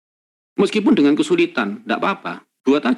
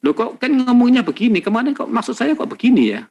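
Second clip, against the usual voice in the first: higher, puzzled student asking the teacher; confused student; 7 semitones higher than usual. Retold or imitated. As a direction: play a bewildered student querying the lesson. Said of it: "Kamu bisa tanya". (mahasiswa bingung bertanya, nada lebih tinggi)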